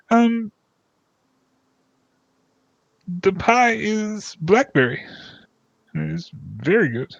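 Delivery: high, slow, proud description; pie man voice. Acted. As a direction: savor the words like a proud baker.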